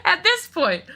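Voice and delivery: laughing through the words. Real, not acted. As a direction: talk while laughing, high. Laughing at the teacher's attitude.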